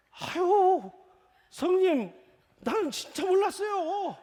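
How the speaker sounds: high, loud, laughing plea to big brother; fawning younger brother. Retold or imitated. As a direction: squeal an apology to the elder. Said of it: "아브라함이 이렇게 얘기해요". (형님께 높은 목소리로 웃으며 비는 동생)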